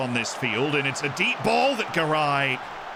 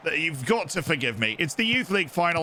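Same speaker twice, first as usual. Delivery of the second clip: put-on a light English accent, apologetic and a bit higher; 4 semitones higher than usual. Streamer pretends to be a late English fan, light English accent. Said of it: "the English accent for the final".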